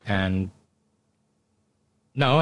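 normal talking voice

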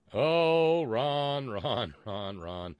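long drawn-out "oh", loud and high, settling down; mock lament. Host teasing a listener by name, mock lament. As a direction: wail the name in mock dismay, easing off.

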